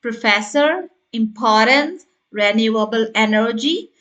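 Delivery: "Professor", "importance" as separate list items with rising ends; key words set apart, "Professor" and "importance" lifted at the end; key words only. Shown as a demonstration. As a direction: list "Professor, importance, renewable energy" with pauses between.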